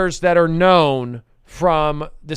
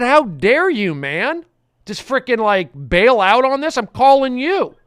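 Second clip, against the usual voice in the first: recalled outrage, raised and higher; host retelling how angry he got; retold anger; 5 semitones higher, 4 dB louder than usual. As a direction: replay your indignation at the quitter, half-performed. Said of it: "I, I got pissed".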